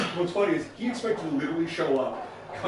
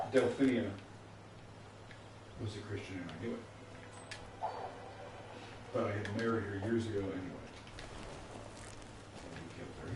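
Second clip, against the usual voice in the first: low and much softer, cold; quiet menace; 7 semitones lower, 12 dB quieter, faster than usual. Acted. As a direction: let the accusation sink in quietly. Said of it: "really intense scene".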